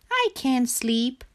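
high, plaintive little frog; high-pitched storybook complaint; sleepless frog. A narrator's reading.

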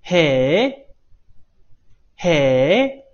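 drawn-out "heee?" dipping then swooping up; exaggerated amazed question; demonstrated surprise. Shown as a demonstration. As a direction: model an astonished rising interjection. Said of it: "它是一个很惊讶的感觉".

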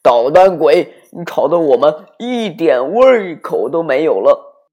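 loud angry scolding parents; scolding shout. A narrator's reading. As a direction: yell at the noisy child. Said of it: "就大声地训斥他".

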